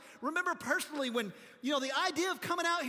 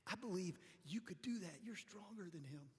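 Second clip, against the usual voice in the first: put-on soft breathy girl's whisper, much softer; 5 semitones lower, 15 dB quieter than usual. Girl whispers to dad watching strongman, hushed. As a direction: hush it into his ear. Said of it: "whispers to her dad".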